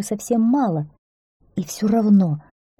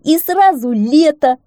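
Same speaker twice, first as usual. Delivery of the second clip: high, loud excited exclamation; hare's jubilant yell; crying out; 10 semitones higher, 8 dB louder than usual. A narrator's reading. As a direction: blurt it out at the top of your voice. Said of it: "крикнул заяц".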